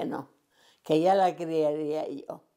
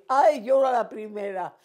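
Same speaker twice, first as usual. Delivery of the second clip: eager, higher and louder; young girl volunteering eagerly; 5 semitones higher, 5 dB louder than usual. Retold or imitated. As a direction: jump at the chance. Her eager young self retold.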